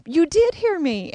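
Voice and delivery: put-on high, loud child's voice insisting; a lightly voiced little girl, thrilled to be heard; eager little girl. The ghost girl tells her she was heard, high and loud. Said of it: "and she said".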